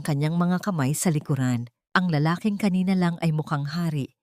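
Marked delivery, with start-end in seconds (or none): none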